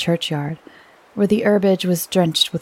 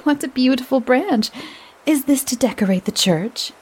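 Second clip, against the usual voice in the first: higher, gushing admiration; 6 semitones higher than usual. A narrator's reading. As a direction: gush over the branch. Dora admiring branch.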